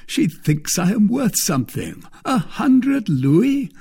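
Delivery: high, lilting amused disbelief. A narrator's reading. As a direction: repeat the sum with mock astonishment.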